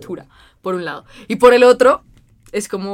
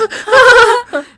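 very high, loud giggling shriek; nervous laugh; 14 semitones higher, 13 dB louder than usual. Retold or imitated. Telling of a crush, nervous high giggle. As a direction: burst into a shrill nervous giggle. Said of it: "de risa nerviosa".

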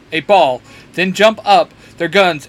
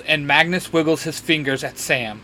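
usual speaking voice

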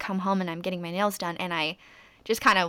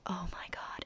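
whispered "oh my God"; 16 dB quieter than usual. Retold or imitated. Retold shock at virality.

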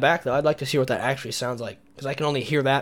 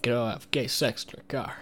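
Patrick Warburton impression; 2 semitones lower, 4 dB quieter than usual. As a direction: deliver it like a deadpan sitcom tough guy. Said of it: "Oh, the Patrick Warburton?".

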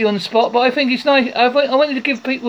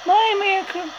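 higher, put-on American accent; 7 semitones higher, 3 dB quieter than usual. Shown as a demonstration. Host redoing an advert for a friend's show, American accent. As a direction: pitch it up into an American announcement. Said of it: "I did an American accent".

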